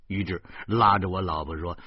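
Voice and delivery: ordinary delivery, nothing marked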